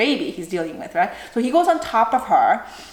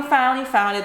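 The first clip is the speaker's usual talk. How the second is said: usual speaking voice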